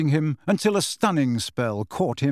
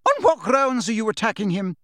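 indignant demand; 8 semitones higher than usual. A narrator's reading. McGonagall confronting the attackers, high indignant demand. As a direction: challenge them with fury.

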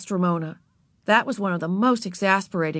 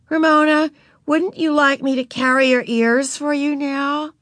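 coaxing; 8 semitones higher, 4 dB louder than usual. A narrator's reading. Beezus coaxing sister.

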